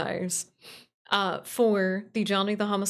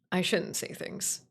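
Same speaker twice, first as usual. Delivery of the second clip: ordinary talk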